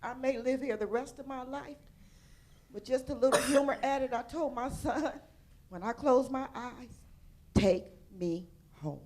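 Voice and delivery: loud, high, tearful; pushing through tears; emotional, near tears. Real, not acted. Woman telling her son her wish, tearful and loud. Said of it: "I'm getting emotional".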